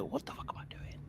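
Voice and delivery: incredulous whisper to himself. Retold or imitated. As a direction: hiss the doubt under your breath. Inner thought facing her.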